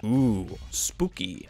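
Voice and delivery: hushed, whispered mock-eerie reaction; mock spooky. Reviewer teasing the Nazi's line about Hitler, mock spooky. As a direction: whisper it with pretend dread.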